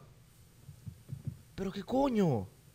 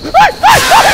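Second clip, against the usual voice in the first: sudden high, loud shrieks; frightened shrieks; 11 dB louder, slower than usual. Real, not acted. Startled in horror game.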